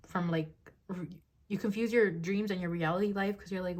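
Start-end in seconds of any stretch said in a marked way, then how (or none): none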